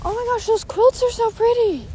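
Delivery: high-pitched delighted admiration; excited gushing. Real, not acted. Walker suddenly spots pretty quilts mid-story, gushing with excitement. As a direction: gush in a high, thrilled voice.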